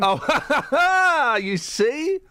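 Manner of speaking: laughing. Real, not acted. Laughs at co-host eating.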